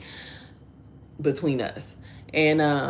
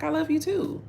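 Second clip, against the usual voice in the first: love you too said much higher; high tender reply; 14 semitones higher than usual. Retold or imitated. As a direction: answer the son sweetly, pitched up. Mother answering son's love.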